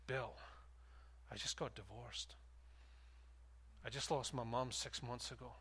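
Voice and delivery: quiet, low, a hint of tears in the voice; softly voicing a grieving man, barely acted; mild retold sob. Pastor retelling a divorced man's grief, quietly tearful. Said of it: "he's speaking through his sobs".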